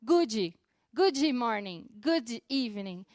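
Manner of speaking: Brazilian accent, "good" as two syllables "goo-djee"; Portuguese-accented English. Shown as a demonstration. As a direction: add a Brazilian "ee" after each "good". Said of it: "pronunciar de uma forma mais aportuguesada".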